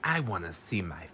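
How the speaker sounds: low breathless whisper; hushed creepy invitation. Retold or imitated. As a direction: whisper it like a creep luring a kid. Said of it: "Kent goes".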